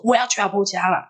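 high-pitched, stubborn outburst; retold fierce insistence. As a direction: act out a cross sleepwalker insisting. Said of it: "他说我很凶".

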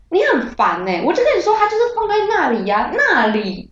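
high, fast, irritable snapping; snappy, irritable. Retold or imitated. Snapping at her brother in the dream, high and irritable. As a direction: snap at him, half-asleep and cranky. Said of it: "我口气还超差".

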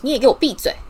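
mother cutting her son short; cross command. A narrator's reading. Mother silences George at the breakfast table, cross command.

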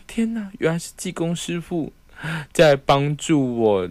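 high, slowed dawning realization; theatrical epiphany, half joking; mock revelation. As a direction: ham up the big "oh my god" moment. Sudden realization, retold dramatically.

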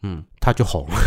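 normal talking voice

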